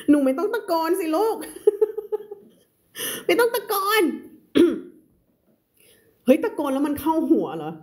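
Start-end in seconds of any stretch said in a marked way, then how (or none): 0.1-4.0 high and laughing while she teases. Real, not acted. Teacher laughing at herself for shouting while she reads. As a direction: giggle through the scolding. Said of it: "ยิ่งอ่านยิ่งตะโกน".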